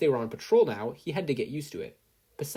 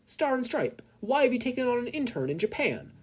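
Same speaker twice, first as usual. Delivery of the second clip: much higher eager reporter; 13 semitones higher than usual. Acted. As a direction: shout a question from the crowd. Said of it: "one reporter asked".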